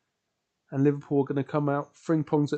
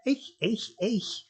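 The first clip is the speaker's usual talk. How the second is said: higher, softer mock Scouse; jokey Scouse catchphrase; attempted Scouse accent; 6 semitones higher, 6 dB quieter, much slower than usual. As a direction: heckle quietly in stock Scouse. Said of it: "that's all I can do in a Scout".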